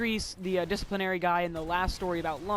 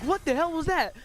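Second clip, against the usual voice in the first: high, louder mock bewilderment; startled outburst; 9 semitones higher, 5 dB louder than usual. Girl stands up looking around, mock startled outburst. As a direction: act out a baffled demand.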